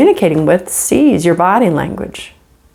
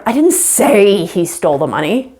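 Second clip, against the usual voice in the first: "say" stretched and raised above the rest; stress on SAY. Shown as a demonstration. Teacher changing inflection on the same words, stress on "say". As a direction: lean hard on "say". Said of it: "you could inflect the other word".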